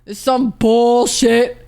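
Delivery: very slow, dragged-out whine; exaggerated slow moan of frustration; stretched-out exasperated complaint. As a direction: drag every word out in disgust. Escalating repetition, stretched out.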